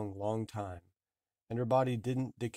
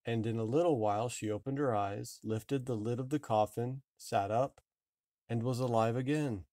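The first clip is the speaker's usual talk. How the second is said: ordinary delivery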